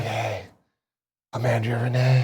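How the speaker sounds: soft raspy tired hello; raspy vocal fry. Retold or imitated. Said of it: "she has that rasp to her".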